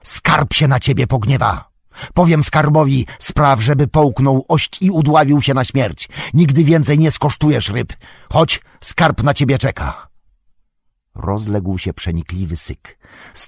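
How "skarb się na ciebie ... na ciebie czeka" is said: loud, high, urgent warning; desperate threat. Acted. Frodo threatening Smigol with the treasure's anger, loud desperate command. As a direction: threaten at raised pitch and volume to force obedience.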